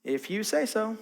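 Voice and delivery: soft, resigned give-up; resigned reply. Retold or imitated. To a lost spouse refusing directions, soft resigned give-up. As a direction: drop it quietly, done arguing. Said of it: "your spouse says".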